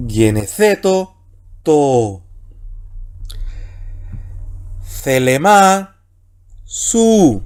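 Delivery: slow, drawn-out Greek syllables; very slow Koine Greek reading. Shown as a demonstration. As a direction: stretch every Greek word slowly. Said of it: "Más lentito ahora".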